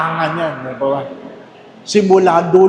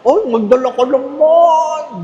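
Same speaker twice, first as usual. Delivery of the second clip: higher, slow coaxing plea; pleading nudge; 7 semitones higher, slower than usual. Acted. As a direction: drag it out, coaxing a friend to bring food. Urging colleague to bring food.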